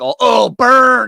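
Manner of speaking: high, loud, slow taunting burn; exaggerated sneering oh oh burn; mocking gloat. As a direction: ham up a smug fan's gloat. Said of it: "they're like".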